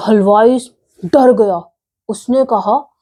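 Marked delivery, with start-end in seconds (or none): none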